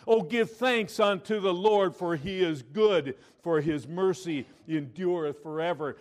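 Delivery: loud, high scripture proclamation; preaching at full force. Acted. Preacher booming a psalm to the church, loud and high. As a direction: proclaim the verse to the rafters.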